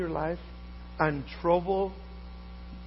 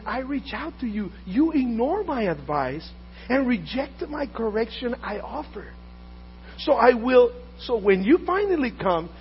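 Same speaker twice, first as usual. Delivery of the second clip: loud, fervent sermon delivery; ringing pulpit voice; full-force preaching; 5 semitones higher, 4 dB louder than usual. Acted. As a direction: proclaim wisdom's warning. Preaches wisdom's words.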